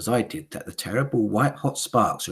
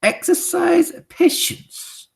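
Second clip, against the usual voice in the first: slow, high stern witch voice; Grand High Witch ordering patience; witch command; 9 dB louder, slower than usual. Acted. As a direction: hold them back with a cold order. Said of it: "answered the Grand High Witch".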